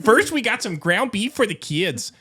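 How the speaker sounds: high mock Minnesota mom accent; chirpy Midwest mom listing her Sam's Club haul. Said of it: "That sounds Canadian".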